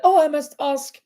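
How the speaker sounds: ordinary talk, nothing marked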